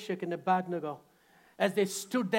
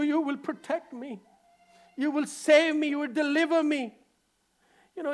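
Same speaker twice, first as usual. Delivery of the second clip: higher, pleading complaint; mock complaint to God; 7 semitones higher, 4 dB louder than usual. A believer complaining to God, mildly pleading. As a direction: whine at God a little. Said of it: "I'm not mocking".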